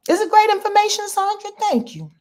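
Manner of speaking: high, loud, quick delighted read; bright thanks. Real, not acted. Host thanking a viewer for her comment, delighted. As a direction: gush over the comment.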